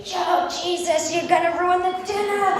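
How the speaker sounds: high-pitched drawn-out protest; hammed-up exasperation; exasperated dad. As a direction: wail it like a fed-up father.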